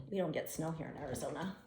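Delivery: ordinary delivery, nothing marked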